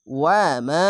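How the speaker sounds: ওয়া মা with the মা drawn out one alif; Quran recitation. Shown as a demonstration. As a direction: stretch the আ of মা. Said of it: "এক আলিফ টেনে পড়বেন".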